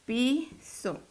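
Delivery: PI, pause, SO; syllable by syllable. Shown as a demonstration. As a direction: split Piso into two separate syllables. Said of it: "Desglosémoslo".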